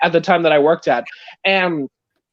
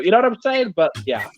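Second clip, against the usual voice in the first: usual speaking voice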